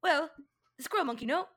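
put-on Southern accent. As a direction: play it as a Southern yokel. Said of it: "the Southern accent".

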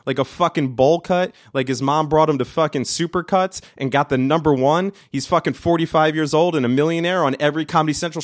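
high-pitched exasperated tirade; indignant comic venting; worked-up angry rant. Real, not acted. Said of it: "Feels good to be angry".

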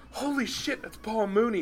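sudden high-pitched cry of recognition; starstruck exclaim. Retold or imitated. Driver spotting comedian in a Cadillac, starstruck high exclaim. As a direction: blurt it out, amazed to spot a celebrity. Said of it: "and I go".

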